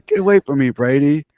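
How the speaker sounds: high, loud squeal pulling away; mock lineman squeal. Friend plays a lineman shoving off Brady, high squeal.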